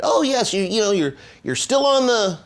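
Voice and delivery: high goofy clown voice. Retold or imitated. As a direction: squeak it like a cartoon clown. Clown college man on phone.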